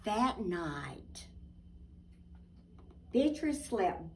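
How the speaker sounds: put-on lively storybook narration for kids; reading to children. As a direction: tell it like a bedtime picture book. Reading picture book aloud.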